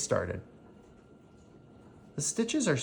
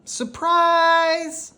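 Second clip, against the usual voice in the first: high, loud cry of surprise; excited shout; 7 dB louder than usual. Acted. A girl surprising her neighbour with a gift, shouting high and loud. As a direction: burst through the door yelling surprise.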